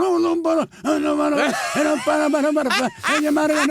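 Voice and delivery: high, garbled imitation of a stage act; mock performer's babble.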